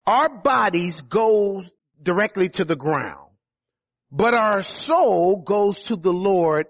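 ordinary talk, nothing marked

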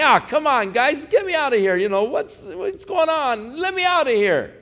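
high, pleading cry from the pit; pleading cry for help. Retold or imitated. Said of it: "he was crying and weeping".

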